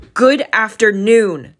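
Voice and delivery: put-on an old miser's last, loud, slow brush-off; final curt dismissal. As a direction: slam the door on the conversation with your voice. Scrooge's final dismissal, escalating.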